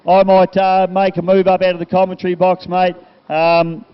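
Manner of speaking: loud PA announcer; big live commentary-box voice; event announcer. Real, not acted. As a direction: address the crowd loudly, warm thanks to the crew.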